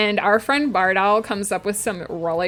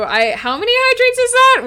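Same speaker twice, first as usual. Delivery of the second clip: much higher, louder startled question; astonished; 14 semitones higher, 7 dB louder than usual. Real, not acted. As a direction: gasp at the number. Counting hydrate redeems.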